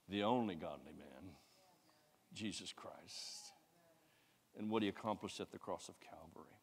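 whispered awe at the cross; reverent whisper. Acted. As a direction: hush it like a sacred secret. Whispered climax of the point.

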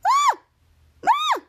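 very high imitated shrieks; piercingly high mock scream; high-pitched scream. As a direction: squeal out two short screams. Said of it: "the muffled, high-pitched voice is like".

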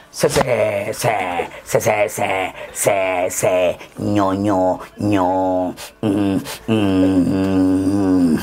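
low, choppy stutter that stalls into humming; deep hammed-up stammer; stuttering customer. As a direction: play a stutterer stuck on señora. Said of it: "le dice a la señora".

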